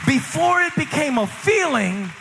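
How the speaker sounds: high, slow, ringing sermon climax; full-force preaching. Acted. Pastor preaching to the congregation, high and slow.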